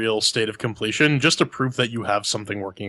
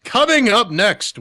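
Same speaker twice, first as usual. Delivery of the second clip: announcer voice; 10 semitones higher, 4 dB louder than usual. Acted. Show host teaser.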